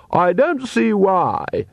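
high, slow and sharp; cutting voice. Acted. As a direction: slice through like a saw. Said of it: "cut through the mist like a saw".

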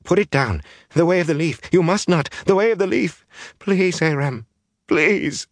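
high, frantic begging; panicked, high-pitched plea; breathless pleading. A narrator's reading. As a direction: beg him desperately, phrase by phrase. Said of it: "she panted breathlessly".

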